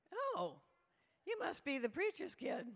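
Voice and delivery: high-pitched gushing "Oh", then an eager greeting; enthusiastic youth leader. Retold or imitated. Youth leader greets the new preacher's kid, enthusiastic. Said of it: "enthusiastic, but very unenlightened youth leader".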